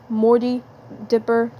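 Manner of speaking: ordinary delivery, nothing marked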